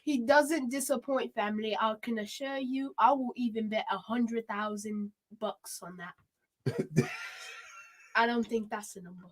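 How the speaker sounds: playful mock English assurance; English accent. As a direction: vouch for him in an English accent. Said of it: "England man".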